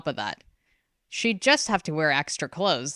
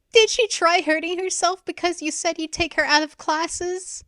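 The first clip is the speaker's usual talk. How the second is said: much higher, louder anguished wail; 14 semitones higher, 4 dB louder than usual. A narrator's reading. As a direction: wail it at father. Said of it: "Ren wailed at last".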